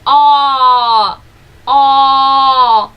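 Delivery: loud, held open O sound; long O vowel demo. Shown as a demonstration. Teacher drilling students on vowels, loud held O. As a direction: stretch the O out loud for the class. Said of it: "Now we practice".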